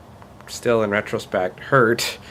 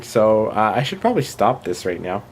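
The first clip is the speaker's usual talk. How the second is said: ordinary talk, nothing marked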